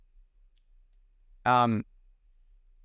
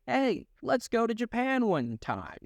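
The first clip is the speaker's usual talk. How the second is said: mock buddy voice; 8 semitones higher than usual. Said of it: "my buddies are like".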